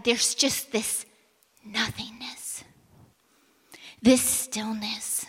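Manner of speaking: slow down, then whisper nothingness; hushed whisper of emptiness. Shown as a demonstration. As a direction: let the voice vanish into nothing. Describes sudden nothingness after fire.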